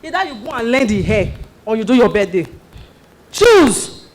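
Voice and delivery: angry big-sister demand; stern ultimatum. Acted. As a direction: bark the ultimatum.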